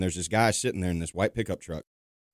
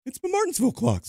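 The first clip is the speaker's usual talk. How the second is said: put-on old man voice.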